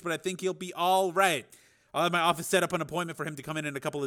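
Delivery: high, lively doctor voice; doctor giving a report. A narrator's reading. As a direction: rattle through the diagnosis with punched key words. Doctor figure gives his report.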